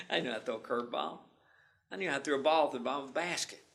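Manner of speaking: usual speaking voice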